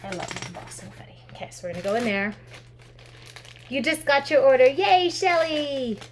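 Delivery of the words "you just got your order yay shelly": higher, louder celebration for a viewer; happy, raised shout-out with a long-drawn yay; excited cheer. Real, not acted. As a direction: cheer them on, stretching out the final cheer. Cheers a viewer receiving her order.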